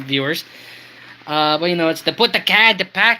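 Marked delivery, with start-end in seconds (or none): 2.1-3.2 rough attempt at South Boston, slightly higher; South Boston accent. Train fan demonstrating Porter's talk, amateur South Boston accent. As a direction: have another go at a Boston accent. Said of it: "I think that's South Boston".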